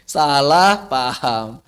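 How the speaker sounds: ordinary talk, nothing marked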